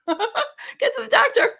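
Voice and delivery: slow and high, laughing through "Get to the doctor"; laughing, high mock urging. Telling the host about her inner voice, laughing high. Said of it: "or like".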